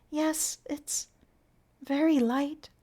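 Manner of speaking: put-on high, wondering girlish voice; dreamy girl. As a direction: marvel at the light like a child. Consuela dreamy.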